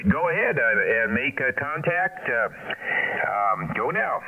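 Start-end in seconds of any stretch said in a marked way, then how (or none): none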